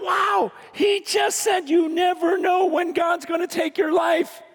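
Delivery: high-pitched retold amazement; astonished. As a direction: marvel at it, voice lifted right up. Retells his astonished thought.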